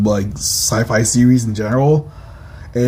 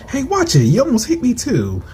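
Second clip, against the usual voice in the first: annoyed protest; 12 semitones higher than usual. Acted. Nearly hit by rescuer's shot.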